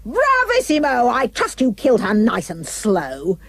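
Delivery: high gleeful cheer; delighted wicked queen; queen crying out. A narrator's reading. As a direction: gloat with cruel delight. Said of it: "The Queen cried out".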